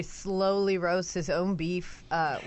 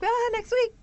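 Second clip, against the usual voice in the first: high cheerful; 3 dB louder than usual. Retold or imitated. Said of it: "I'm like".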